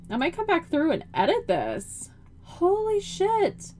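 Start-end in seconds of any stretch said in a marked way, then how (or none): 2.4-3.7 amazed. Real, not acted. Amazed at the museum build.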